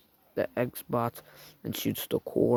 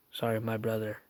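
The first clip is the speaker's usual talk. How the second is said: ordinary delivery, nothing marked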